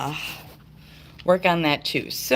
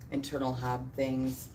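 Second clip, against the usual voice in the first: normal talking voice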